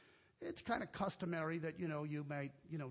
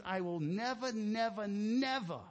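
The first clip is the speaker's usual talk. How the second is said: each "never" hit harder and louder, building to the third; emphatic never; 3 semitones higher, 4 dB louder than usual. Shown as a demonstration. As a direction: stack the "never"s, growing louder each time. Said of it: "that's an emphatic ne-, negative".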